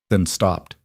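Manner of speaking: plain reading voice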